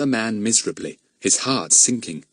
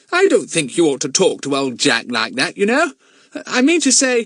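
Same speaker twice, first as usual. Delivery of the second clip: put-on high, louder boyish protest; nervous nephew; 9 semitones higher, 5 dB louder than usual. Lucian standing up for Jack to his uncle, high protest. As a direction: object in a piping, flustered voice.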